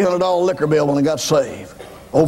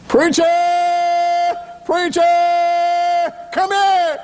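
hollering; 13 semitones higher, much slower than usual. Retold or imitated. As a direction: yell for the preacher to come. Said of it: "And I hollered".

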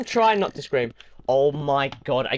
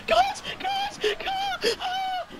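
high squealing calls of fear; frightened squeal; 6 dB quieter, much slower than usual. Real, not acted. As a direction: squeal their names in panic. Scared kid screaming for friends.

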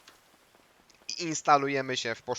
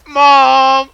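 excited shout; 13 semitones higher, 21 dB louder than usual. Real, not acted. Triumphant shout.

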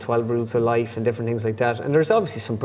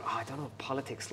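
mock mumble; 15 dB quieter than usual.

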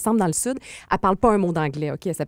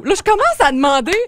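ordinary talk

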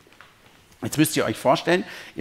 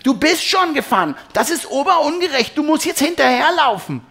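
sehr hoch und laut, gedehnt; brüll empört wie ein kleines Kind; brüllendes Kind; 7 dB louder than usual. A narrator's reading. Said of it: "Sie ist oft sehr laut". (lautes Kindergebrüll)

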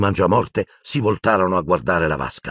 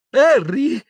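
high, rising giddy call; giggly; 5 dB louder than usual. A narrator's reading. Dazed Ron leans to Harry's ear, giggly. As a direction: call the name as if bursting to laugh. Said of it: "senza smettere di ridere".

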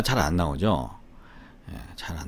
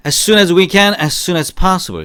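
model phrase; 7 semitones higher, 6 dB louder, much slower than usual. Shown as a demonstration. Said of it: "시작".

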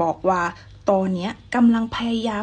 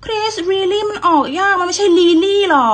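higher, louder complaining student voice; whiny pupil; 9 semitones higher, 8 dB louder than usual. Pretend. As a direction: play the frustrated pupil. Pupil role complains again.

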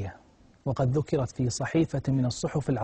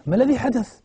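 high, loud burst of alarm; alarmed question; 11 semitones higher, 6 dB louder, faster than usual. Acted. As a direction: demand urgently, as a frightened parent. أب يسأل ابنته الباكية عما حدث. (صيحة سؤال مفزوعة)

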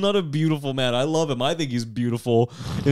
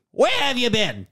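May voice; 8 semitones higher than usual. Retold or imitated. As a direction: scold him as the wife. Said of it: "this is my voice for May".

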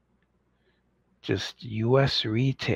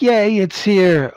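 high, loud, slow mock cheer; 7 semitones higher, 9 dB louder, slower than usual. Australian fans finally getting the figure, hammed-up loud cheer. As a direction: cheer like a thrilled Australian fan. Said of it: "they'll be like".

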